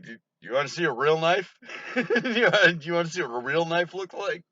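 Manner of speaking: mock the cousin through giggles; hammed-up badass quote cracking up with laughter; laughing mock tough guy. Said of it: "I had to go outside and laugh".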